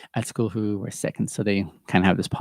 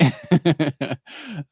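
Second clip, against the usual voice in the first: a light, fond laugh; real laugh; 6 semitones higher, 3 dB louder than usual. Real, not acted. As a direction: chuckle at your son. After addressing son.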